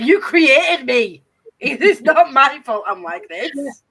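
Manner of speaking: high, loud, drawn-out protest; mock indignant protest. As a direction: blame Mum with hammed-up outrage. Mock argument retold with her mum.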